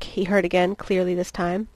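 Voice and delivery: usual speaking voice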